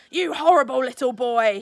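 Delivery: put-on loud angry roar at the boy. A narrator's reading. Elephant master roaring at Chulak, loud and angry. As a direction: bellow it at him. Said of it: "he roared".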